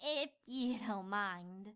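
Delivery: slow, soft and low smug. Acted. Twilight smugly dismissing the narrator, slow and soft. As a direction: say it smiling, slowly and smug. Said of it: "with a smug smile".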